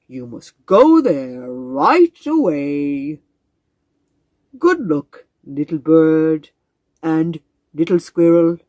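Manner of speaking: slow, booming, stately frog voice; a proud old frog greeting his visitors; grand wise frog. Acted. Frog sends them off.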